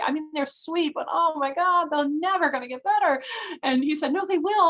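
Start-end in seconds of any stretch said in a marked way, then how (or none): 0.0-3.2 higher, half-laughing exasperation at endless cases; exasperated disbelief. Retold or imitated. Retelling talk with colleague Mel. 4.1-4.7 much higher, bright earnest insistence; earnest colleague. Retold or imitated. Mel answers her doubt.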